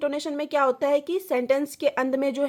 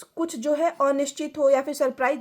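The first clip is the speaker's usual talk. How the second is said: ordinary talk, nothing marked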